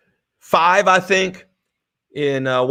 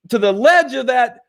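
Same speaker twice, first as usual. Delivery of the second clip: ordinary delivery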